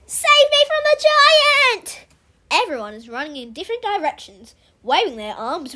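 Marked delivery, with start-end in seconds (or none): 0.1-2.1 high, loud, drawn-out scream. Acted. Pupil fleeing an imaginary giant, screaming. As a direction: scream it out in mock terror. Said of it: "Julia Morton is screaming".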